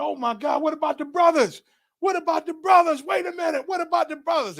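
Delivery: high, pleading shout; worried shout. Retold or imitated. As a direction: call out desperately for the others left behind. Shouting worried about drowning brothers.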